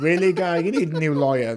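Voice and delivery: loud, exasperated disbelief; incredulous. Real, not acted. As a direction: scoff at him loudly. Mocking disbelief.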